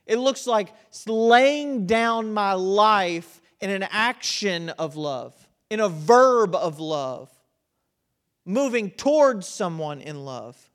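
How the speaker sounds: drawn out, each phrase weighed; slow emphatic preaching. Real, not acted. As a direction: lay each phrase down slowly and firmly. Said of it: "preaching emphasis, slowed".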